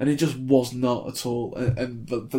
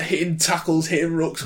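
usual speaking voice

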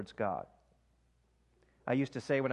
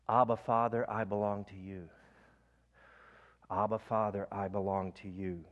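breath prayer demo; 4 semitones lower than usual. Shown as a demonstration. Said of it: "Let me give you an example".

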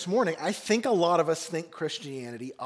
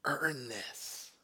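faint, hushed last words, much softer; dying breath; 3 semitones lower, 8 dB quieter than usual. Retold or imitated. Dying captain to Ryan, faint hushed last words. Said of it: "with his dying breath".

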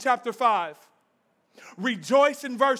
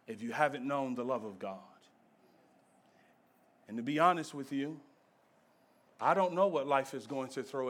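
much softer, lower, close to the listener; soft intimate preaching; 11 semitones lower, 9 dB quieter than usual. Acted. Preacher promising God shows up, soft and gentle. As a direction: speak it gently to each one.

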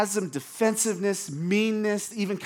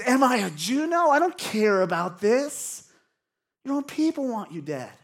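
higher, louder dismissive retort; defensive, sarcastic; 6 semitones higher, 4 dB louder than usual. Acted. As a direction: brush it off with acted scorn. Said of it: "He's defensive. He's sarcastic. He's mean".

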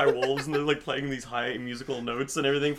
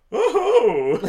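surprised gasp; 14 semitones higher, 11 dB louder than usual. Retold or imitated. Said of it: "one would go".

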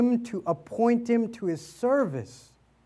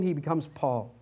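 ordinary talk, nothing marked

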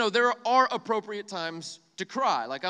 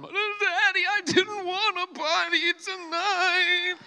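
put-on much higher, slow whiny little-kid voice; whiny toddler; 13 semitones higher, 7 dB louder, much slower than usual. Father imitating his crying son at the potty. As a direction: whine like a melting-down toddler. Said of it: "full-on meltdown".

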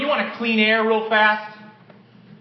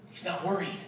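He's not worried repeated much softer; hushed repeat; 4 semitones lower, 12 dB quieter than usual. Shown as a demonstration. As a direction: let He's not worried fade almost to a hush.

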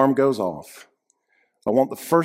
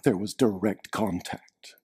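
hushed, slow whisper; 6 semitones lower, 8 dB quieter, slower than usual. Real, not acted. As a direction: lean in and whisper it. Dramatic reveal, whispered.

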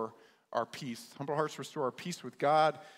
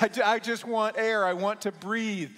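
higher, louder, then slowing to beg; desperate plea; 7 semitones higher, 7 dB louder than usual. Retold or imitated. Young man pulled from the water pleads to breathe. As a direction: beg to breathe, half laughing.

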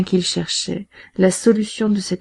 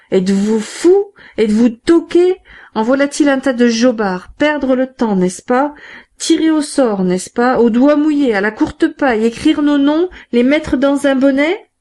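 higher and louder, mocking outrage; exasperated exclaiming; 6 semitones higher, 5 dB louder than usual. A narrator's reading. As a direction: scoff at the gang's foolishness, building. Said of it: "Thénardier s'exclama".

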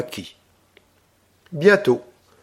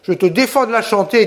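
voix terrible; 7 semitones higher than usual. A narrator's reading. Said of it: "d'une voix terrible".

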